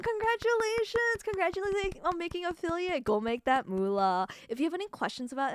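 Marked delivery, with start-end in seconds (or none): 0.0-3.0 excited congratulations. Real, not acted. Congratulating viewer on making affiliate.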